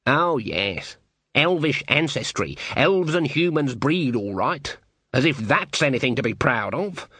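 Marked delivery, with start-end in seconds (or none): none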